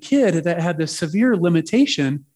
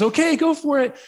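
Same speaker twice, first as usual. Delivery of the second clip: higher-pitched encouraging call; lightly voiced dad; 11 semitones higher than usual. Dad in the pool to his boy, light imitated cheer.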